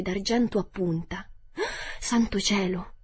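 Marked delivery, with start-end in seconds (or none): none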